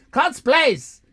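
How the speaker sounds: loud, high call of the name. Real, not acted. Taunting the viewer Cots Plays, loud high call. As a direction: shout the name to grab his attention.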